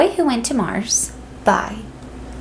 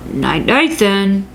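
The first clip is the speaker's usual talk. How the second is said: dad voice; slower than usual. A narrator's reading. Dad says goodnight.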